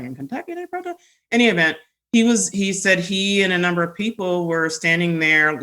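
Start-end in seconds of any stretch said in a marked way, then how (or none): 0.0-1.0 soft aside, voice rising high at the end; quietly puzzled, pitch leaping up on the question; mock incredulous. Retells her surprised reaction to protests.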